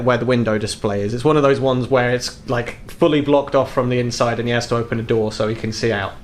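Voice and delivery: ordinary talk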